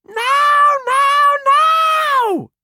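put-on piercing, very high child's tantrum scream; ear-splitting shriek. A narrator's reading. Ruby about to pass out, shrieking. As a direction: shriek each word, holding the last. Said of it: "Her shriek was ear-splitting".

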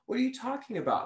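high, incredulous what-are-you-talking-about; baffled disbelief. Retold or imitated. Retelling her own reaction to a client, baffled and pitched high.

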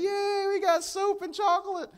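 put-on very high, squealing child voice; excited kids. Kids thrilled over hotel soap, squealing high. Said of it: "They're like".

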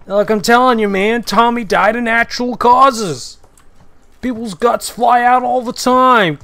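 loud, high mocking quote of scoffers; mock dismissive. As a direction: loudly brush off the drunk's story, hammed-up. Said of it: "dismissive of this guy".

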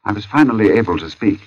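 usual speaking voice

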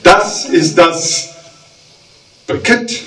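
rhythmic cabaret verse reading; mock-solemn poetry reading; poem recital. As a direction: recite the verse with comic timing.

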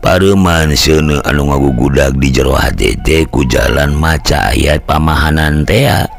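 plain reading voice

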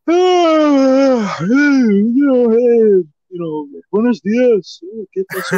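loud, high, drawn-out hello; imitated houseguest greeting. Super Crazy coming out from the other side, imitated loud drawn-out greeting. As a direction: stretch out a high, slow greeting as the imitated guest. Houseguest emerging from the guest room.

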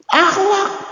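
high punchline. Acted. Sudden punchline word.

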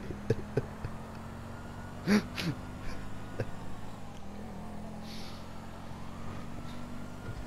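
low breathy villain chuckle; deep evil laugh. Pretend. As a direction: chuckle darkly like a clown. Clown roleplay laugh.

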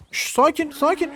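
very high-pitched shushing; urgent calming. Pretend. Rider to a bucking horse, urgent high calming.

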